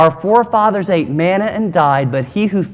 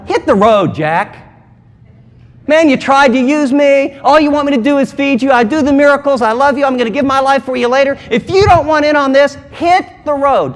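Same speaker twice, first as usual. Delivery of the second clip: higher and louder, exasperated brush-off; fed-up dismissal; 6 semitones higher, 3 dB louder than usual. Retold or imitated. Pastor imagining telling grumblers off, exasperated and loud. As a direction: tell the grumblers to get lost, louder and higher. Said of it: "I'd tell them".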